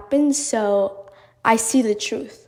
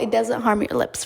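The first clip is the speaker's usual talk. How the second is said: ordinary talk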